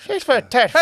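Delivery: high-pitched Irish caricature; mock Irish accent. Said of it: "And then the Irish are like".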